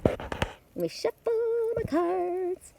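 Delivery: high, slow breathy singsong; playful singsong. Pretend. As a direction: sing it out playfully, high and drawn out. Playful aside while shuffling cards.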